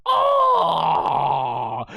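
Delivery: loud, long mock cry of a punched fighter; big drawn-out "oh" of impact; hammed-up yell from a heavy blow; pained cry. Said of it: "And Goma's like".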